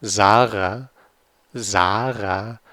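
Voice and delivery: "Zara" with the Z as a voiced z and a throaty r; German pronunciation. Shown as a demonstration. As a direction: buzz the z in "Zara". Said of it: "it's Z".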